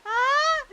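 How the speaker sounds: high, loud wail of frustration; strained cry. Real, not acted. Streamer struggling with the game, loud high wail.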